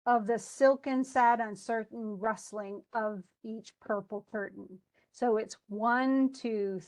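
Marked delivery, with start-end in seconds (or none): 0.0-4.8 "Of the silken, sad" read with each beat marked; scanned reading. Shown as a demonstration. Teacher reading the line by its feet for the student. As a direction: tap out every foot. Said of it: "it's like".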